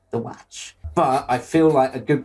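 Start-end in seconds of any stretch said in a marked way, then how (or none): 0.1-0.8 softer, put-on American "watch" with an open flat "a"; Christopher Walken impression. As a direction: say "watch" like "watch" rhyming with "catch", softly. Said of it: "Christopher Walken".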